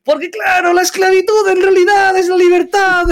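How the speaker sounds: high shouted alien-trader parody voice; Castor Peck voice. Acted. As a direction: preach it up high like a smug neoliberal huckster. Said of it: "la parodia de un neoliberal".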